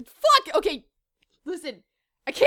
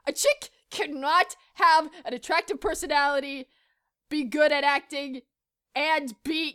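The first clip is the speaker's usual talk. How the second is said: normal talking voice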